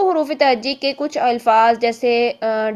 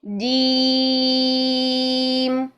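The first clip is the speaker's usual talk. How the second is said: जीम drawn out very long and slow; six-count stretch; much slower than usual. Shown as a demonstration. Teacher recites जीम stretched for six counts. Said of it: "ये हमने छः हरकत तक".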